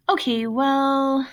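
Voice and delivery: slow halting stammer. A narrator's reading. Colleen stammering to Jeff, slow and halting. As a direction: drag out the words, embarrassed. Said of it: "stammered Colleen".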